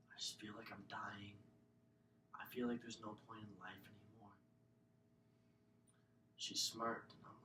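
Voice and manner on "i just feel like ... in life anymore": soft, breathy, lower confession; hushed despair. Retold or imitated. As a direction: barely voice it, hollowed out. Telling nurse he feels dying.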